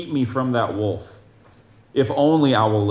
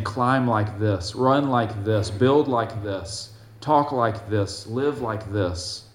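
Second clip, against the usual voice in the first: patient guiding; slower than usual. Acted. God guiding, step by step.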